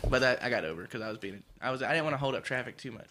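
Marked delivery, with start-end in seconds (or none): none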